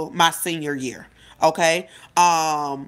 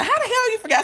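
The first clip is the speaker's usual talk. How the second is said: much higher, incredulous squeal; incredulous friend; faster than usual. Retold or imitated. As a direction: squeak out disbelief. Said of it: "She said".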